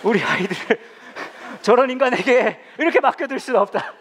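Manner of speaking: high, loud mock indignation through laughter; outraged mothers. Mothers at the emergency meeting, in hammed-up outrage. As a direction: play the scandalized camp moms, half laughing. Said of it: "엄마들이 대책회의를 했대요".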